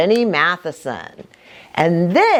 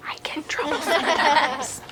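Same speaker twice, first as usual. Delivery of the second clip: soft confiding whisper; whispered aside; 6 semitones higher, 7 dB quieter, faster than usual. Real, not acted. Pastor confides to the kids in a whisper.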